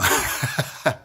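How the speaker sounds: a quick real laugh at himself; short laugh. Real, not acted. Man laughing at his own story to viewers, short laugh. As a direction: let a brief laugh slip out.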